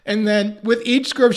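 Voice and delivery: ordinary talk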